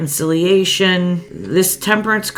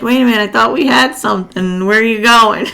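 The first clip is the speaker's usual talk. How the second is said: fast, higher and louder, playfully shocked; mock dismay; 5 semitones higher, 5 dB louder, faster than usual. Reader voicing someone whose twin flame ran off, mock stunned. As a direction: act out a half-joking stunned lover. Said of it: "You're like".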